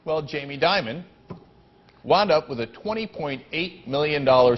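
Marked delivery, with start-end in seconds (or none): none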